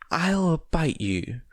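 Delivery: put-on a small child trying to sound menacing; mock menacing child. A narrator's reading. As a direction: Eri threatening the investigators, slow. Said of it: "repeated Eri".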